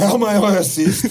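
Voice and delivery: hammed-up courteous helper; mock polite helper. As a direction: play the painter as an eager servant. Painter mocked as posh helper.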